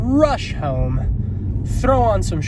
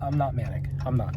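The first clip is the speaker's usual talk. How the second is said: "I'm not manic" said much softer, falling on NOT; quiet denial; 2 semitones lower, 9 dB quieter than usual. Real, not acted. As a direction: mutter it to yourself, softly. Denying being manic.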